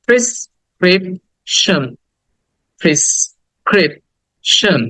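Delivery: slow "prescription", pris, krip, shan; syllable by syllable. Shown as a demonstration. Teacher drilling prescription with students, slow syllables. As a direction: leave gaps inside "prescription". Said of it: "আবার বলো".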